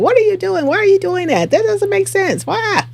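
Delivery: high-pitched exasperated mock yell; mock fussing at TV. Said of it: "yelling and fussing at the T V".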